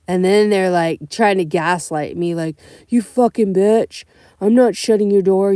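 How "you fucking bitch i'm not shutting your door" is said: angry passenger. Retold or imitated. Rude passenger voiced in story.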